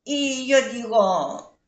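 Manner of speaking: normal talking voice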